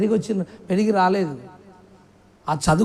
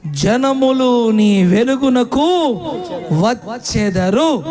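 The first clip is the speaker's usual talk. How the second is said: slow, drawn-out, louder verse reading; emphatic scripture reading; 4 dB louder, much slower than usual. Acted. Preacher reading the verse slowly to the church. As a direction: stretch every word of the verse out.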